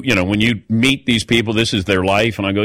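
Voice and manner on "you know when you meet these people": ordinary delivery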